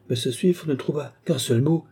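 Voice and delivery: usual speaking voice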